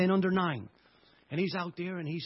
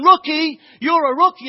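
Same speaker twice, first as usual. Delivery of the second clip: put-on furious boy's scream, far louder and higher; angry taunting yell; 9 semitones higher, 16 dB louder than usual. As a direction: bellow the insult with rising anger. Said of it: "He was going".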